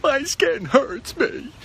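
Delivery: very slow, high whine; whiny kid. Retold or imitated. Sunburnt boy whining to mom, slow and high. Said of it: "I'd come home and be like".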